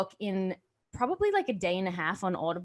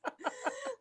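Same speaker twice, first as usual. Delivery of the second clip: high giggle; 11 dB quieter than usual. Real, not acted. Laughs before changing topic.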